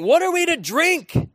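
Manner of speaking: a mock-angry gripe at Moses; grumbling demand. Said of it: "They grumbled against Moses, saying".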